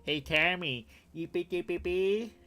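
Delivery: mock Vietnamese accent. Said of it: "I'd be like".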